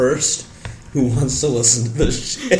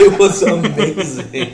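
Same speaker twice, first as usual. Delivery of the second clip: laughing; 4 semitones higher, 4 dB louder, slower than usual. Real, not acted. Laughing recollection of hot sauce.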